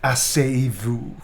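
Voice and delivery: slow, lightly seductive "Asseyez-vous"; mock seductive invitation; romantic line. Reading a romantic line to chat, slow and mock seductive. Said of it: "Romantic blushing type line".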